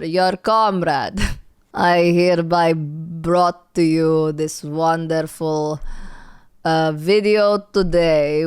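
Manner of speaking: slow, put-on Russian accent. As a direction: greet the stream slowly in a mock Russian accent. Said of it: "I don't know why I'm talking in a Rus-, Russian accent".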